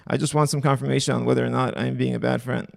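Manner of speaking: usual speaking voice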